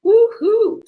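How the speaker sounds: loud, high whoop of joy; excited cheer. Real, not acted. Cheering to the audience with a loud high whoop. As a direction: let out a big happy woohoo.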